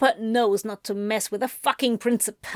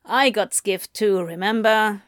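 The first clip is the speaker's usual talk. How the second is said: ordinary delivery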